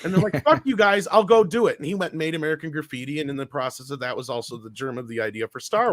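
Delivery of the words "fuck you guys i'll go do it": loud, high defiant retort; mock pissy. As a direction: imitate someone storming off in a huff. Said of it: "George got all pissy".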